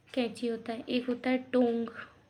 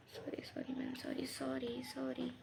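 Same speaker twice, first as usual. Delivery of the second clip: much softer flustered "sorry" repeated; hushed apology; 12 dB quieter than usual. Real, not acted. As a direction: apologise quietly to ma'am, away from the class. Apologizing to someone off-lesson.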